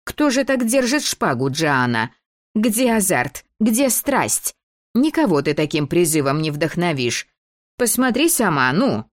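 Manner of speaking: высоко, напористо, с упрёком; отчитать актрису как строгая постановщица; властная девочка-режиссёр. Acted. Дебора отчитывает Фиби. (командным тоном)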